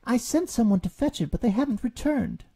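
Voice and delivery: high small beetle voice; timid beetle. A narrator's reading. Alexander Beetle excuses himself to Rabbit, high and small. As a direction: explain it nervously.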